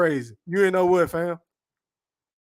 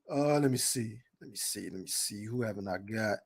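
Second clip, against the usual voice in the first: muttered to himself; 9 semitones lower, 11 dB quieter than usual. Real, not acted. Muttering to himself reading chat.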